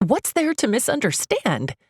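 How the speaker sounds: a pleading, indignant cry. Acted. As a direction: cry out at him in disbelief. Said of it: "I cry".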